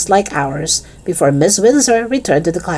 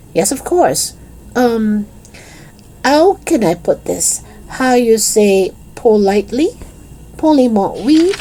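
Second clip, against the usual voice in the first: put-on higher voice, halting French-accented English; tentative French accent; 5 semitones higher than usual. Fake French pupil searching for polite words, tentative French accent. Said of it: "in a tentative French accent".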